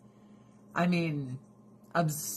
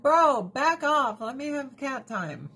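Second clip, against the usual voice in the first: mock warning; 8 semitones higher, 5 dB louder than usual. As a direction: drag it out like a playful threat. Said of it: "I'm like".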